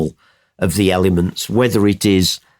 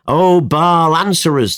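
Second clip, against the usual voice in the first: higher, slightly raised cry to a god; mild shout; 6 semitones higher than usual. A narrator's reading. Prophets call on Baal to answer, mild shout. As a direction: call out in appeal, not at full volume. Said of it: "they shouted".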